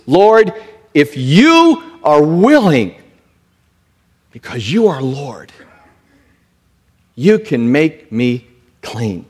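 plead, then settle into trust; emphatic plea. Acted. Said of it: "It was a point of emphasis".

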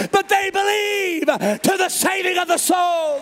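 preacher pressing the church on selling out; preaching at full force. Acted. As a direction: hammer the question at the congregation. Preaching climax.

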